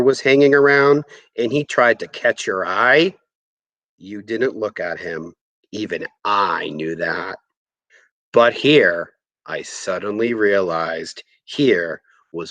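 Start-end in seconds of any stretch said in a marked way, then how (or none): none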